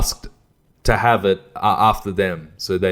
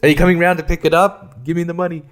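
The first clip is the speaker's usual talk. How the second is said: high, louder pushy demands; impatient mock demand; 8 semitones higher, 4 dB louder than usual. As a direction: push them to hurry up. Retold impatience at buyers.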